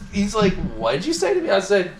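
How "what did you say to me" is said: much higher, indignant squeak; mock outrage. Boss Bryce reacting to an insult, mock outraged. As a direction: act shocked and offended.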